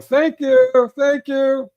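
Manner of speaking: very high, loud singsong call; high hollered mock thank-you. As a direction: call it across to the other car. Acts Candy calling out thanks.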